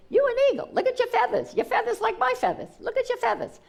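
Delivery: high, hammed-up eagle voice; big eagle insisting. Big eagle urging the baby eagle, high playful voice.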